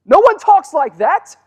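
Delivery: high, loud exclamation of amazement; exclaiming. Real, not acted. Preacher marveling to his congregation, high and loud.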